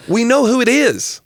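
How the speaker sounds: high-pitched comic exasperation; worked-up, high-pitched insistence; up in arms. Real, not acted. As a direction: protest it as if it were obvious. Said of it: "strange thing to be up in arms about".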